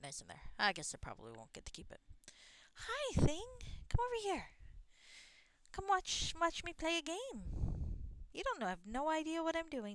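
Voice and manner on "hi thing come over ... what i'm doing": very high, loud cooing to a cat; pet-talk voice. Real, not acted. Player inviting the cat to watch the game, high pet-talk voice. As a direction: coo high and singsong to a pet.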